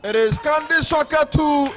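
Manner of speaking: excited shout. Real, not acted. Repeats goal call.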